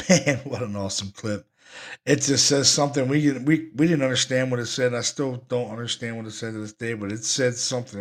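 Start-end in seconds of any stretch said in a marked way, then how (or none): none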